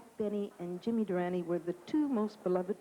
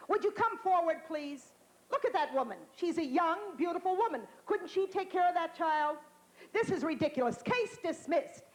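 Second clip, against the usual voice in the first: judge; 7 semitones higher than usual. Retold or imitated. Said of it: "He says".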